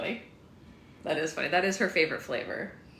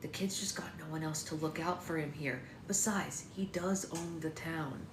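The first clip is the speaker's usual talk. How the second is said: softer, slow confiding; lowered voice; 7 dB quieter than usual. A narrator's reading. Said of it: "Mikey lowered the tone of his voice".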